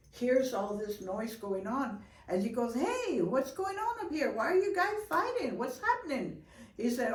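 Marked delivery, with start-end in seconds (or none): 2.7-6.4 Grandfather calling out. Acted. Grandfather interrupts the fight.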